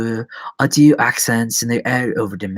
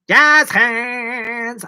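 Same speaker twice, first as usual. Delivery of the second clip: jazz hands flourish; 10 semitones higher, 4 dB louder than usual. Pretend. Showy exclamation.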